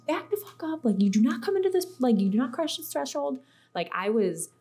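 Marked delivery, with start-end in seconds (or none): 0.1-3.4 high, forceful mock yell; retold screaming. Retelling a stand at the bathroom threshold, high mock scream. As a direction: order an intruder back, voice pitched up. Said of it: "could hear me outside of my house screaming".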